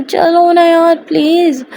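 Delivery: slow, drawn-out wheedling plea; pleading coax. Acted. Coaxing a sulking partner to go.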